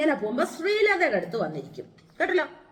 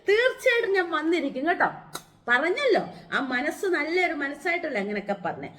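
ordinary talk

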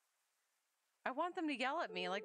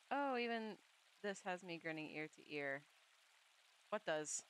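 ordinary talk, nothing marked

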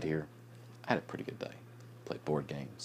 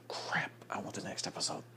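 whispered; 4 semitones higher, 4 dB quieter than usual. Retold or imitated. Quoting own reaction after episode.

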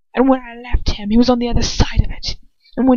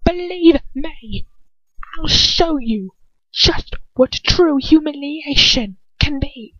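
bitter rage; 9 semitones higher than usual. Acted. Villain raging at mockery.

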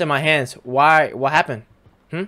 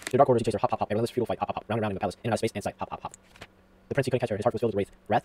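rushed story reading; 2 semitones lower, 5 dB quieter, much faster than usual. Acted. Player reading a storybook to the baby, rushed story reading. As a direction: rattle through the storybook quietly.

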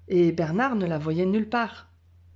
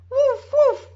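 very high, loud barks; frightened barking; 8 dB louder than usual. A narrator's reading. Lost dog barking in the snowstorm, very high and loud. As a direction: bark out in fear in the storm. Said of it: "Bernard aboyait".

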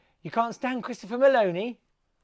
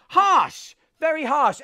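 loud, high cry of shock; mock shocked; 9 semitones higher, 9 dB louder, much slower than usual. Real, not acted. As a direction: gasp it out, scandalised. Reacting to a rude tweet.

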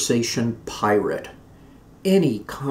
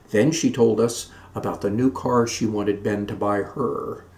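ordinary delivery, nothing marked

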